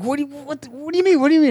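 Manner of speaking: high, stammered retold protest; flustered reply. Acting out the reply to yelling from the office, flustered.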